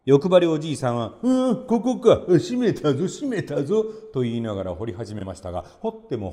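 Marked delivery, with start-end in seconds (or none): 1.2-3.8 高めに笑いまじりでゆっくり; しめしめと喜ぶ欲深な老人; ほくそ笑む欲張りじいさん. A narrator's reading. 欲張りじいさんがほくそ笑む. (笑いをこらえきれない欲張り爺さん)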